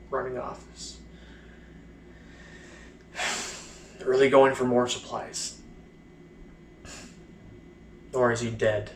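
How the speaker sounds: halting, bitter recollection, long silences between phrases; mostly subdued, dragging each painful fragment out; letting out repressed emotion. Acted. Blinded soldier recalling the trench, letting out repressed bitterness haltingly. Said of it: "letting out some, some negative emotion".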